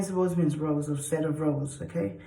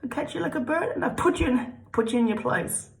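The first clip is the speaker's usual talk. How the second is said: playful strict mom; 9 semitones higher than usual. Acted. Strict mom catching kids.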